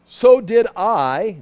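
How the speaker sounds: slow, emphatic quoted line; scripture reading. Acted. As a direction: stretch the words out solemnly.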